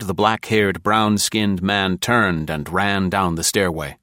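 usual speaking voice

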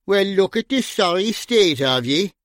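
dry, teasing call across the room; wry Irish greeting. A narrator's reading. Bunny eyeing his drunk friend Gringo, dry and teasing. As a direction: needle him about the state he's in.

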